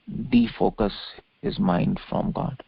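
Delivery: normal talking voice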